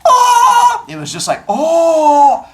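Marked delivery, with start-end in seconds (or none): 0.1-0.9 very high, loud shriek of oh; a sharp startled shriek; high shocked oh. Shown as a demonstration. Teacher contrasting two kinds of scream. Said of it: "Two different ahs". 1.5-2.5 high, loud oh with a laugh; a delighted yelp. Shown as a demonstration. Teacher showing the other kind of ah. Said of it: "Two different ahs".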